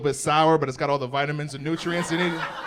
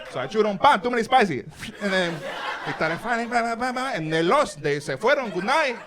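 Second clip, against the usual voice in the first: put-on Mexican accent; 2 semitones higher than usual. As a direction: tell the alien fight in broken accented English. Hero retells alien fight accented.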